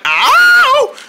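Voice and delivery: high, loud yelp of a scream; Wilhelm scream imitation. Shown as a demonstration. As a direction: demonstrate the famous movie scream. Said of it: "the scream that goes like".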